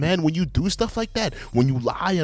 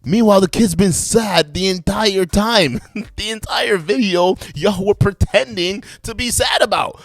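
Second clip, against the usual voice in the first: raised, higher-pitched indignant outburst; indignant rebuttal; 6 semitones higher, 4 dB louder than usual. Real, not acted. Reactor accusing the parents of pretending, indignant raised voice. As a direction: call them out loudly, voice climbing.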